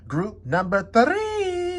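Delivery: stretched slow and high, singsong; drawn-out singsong. Shown as a demonstration. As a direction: sing it out slowly like a greeting. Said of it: "sign-off call to the group".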